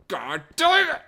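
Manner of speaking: very high, loud frustrated cry; high-pitched, shouted curse; exasperated yell. Real, not acted. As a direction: explode in frustration. Failed build, swears in frustration.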